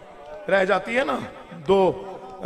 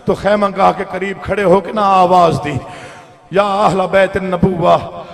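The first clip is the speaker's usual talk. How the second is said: louder, higher wailing majlis recitation, slowing on the key lines; preaching at full force; 3 semitones higher, 13 dB louder than usual. Acted. Orator recites Husain's martyrdom to weeping mourners, preaching at full force. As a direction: pour grief into every line for the mourners.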